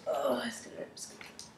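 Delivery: whispered dread of pain. Real, not acted. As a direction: breathe it out in fear of the pain. Dreading pain, mouth hurts.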